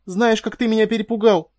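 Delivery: high, loud, tearful accusation; high-pitched outburst after a fright; upset reproach. A narrator's reading. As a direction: cry out in shaken reproach. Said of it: "выдавила она".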